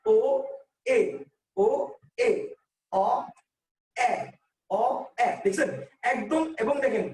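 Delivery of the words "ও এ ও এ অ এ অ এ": ও and এ in turn, then অ and open এ, each isolated; alternating vowel demo. Shown as a demonstration. Teacher asking students to try it, alternating rounded ও, অ with spread এ. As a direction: switch rounded ও, অ against spread এ, with gaps. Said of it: "উচ্চারণ করে দেখেন".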